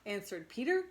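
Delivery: ordinary delivery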